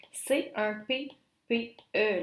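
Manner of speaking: P P E spelled letter by letter, slow and deliberate; spelled out slowly. Shown as a demonstration. Teacher naming the rule for students, slow spelling. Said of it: "teacher spells the grammar term".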